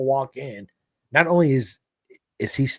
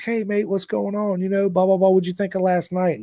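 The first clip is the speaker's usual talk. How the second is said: lightly voiced cheerful dad, higher; a hint of a chummy Manx father; friendly British dad; 8 semitones higher than usual. Dad greets him in story.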